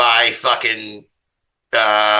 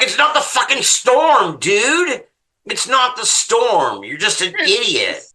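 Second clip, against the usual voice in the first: exasperated retort; 10 semitones higher, slower than usual. Retold or imitated. Retorting to husband.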